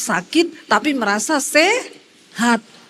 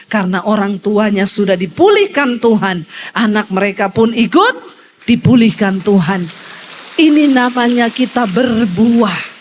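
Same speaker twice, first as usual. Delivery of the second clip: louder, emphatic pulpit delivery; full-force preaching; 5 semitones lower, 5 dB louder than usual. Acted. Pastor preaching to the women's congregation, loud and emphatic. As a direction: hammer the point home to the congregation. Preacher drives home the point.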